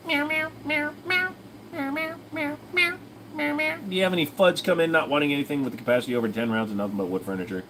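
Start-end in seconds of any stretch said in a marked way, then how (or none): 0.1-3.8 high, soft cat meowing; high tiny cat voice; cat meows. Pretend. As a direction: meow like a kitten. Meowing at the stream.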